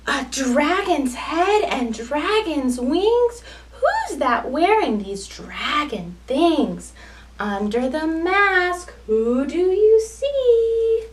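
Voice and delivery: put-on lively sing-song storytelling for small children; picture-book read-aloud. A narrator's reading.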